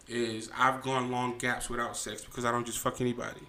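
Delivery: usual speaking voice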